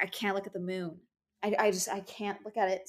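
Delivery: softly voiced mock reluctance; hushed, halting pretend excuse; uneasy acted excuse. Fifth grader pretending to be a werewolf at dinner, softly uneasy. Acting younger self avoiding moon.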